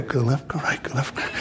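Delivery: near-whispered, low mumbled echo; barely audible repetition. Retold or imitated. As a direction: mumble it back, lost and unsure. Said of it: "I go".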